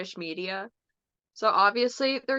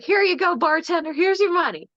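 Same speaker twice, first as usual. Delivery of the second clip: cheery handover; 7 semitones higher, 6 dB louder than usual. Retold or imitated. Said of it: "and he's like".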